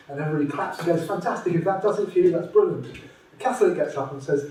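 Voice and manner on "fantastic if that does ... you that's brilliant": higher cheery approval, lightly hammed; polite crowd gushing on cue; mock enthusiastic crowd. The hall cheers the Taoist, lightly hammed enthusiasm.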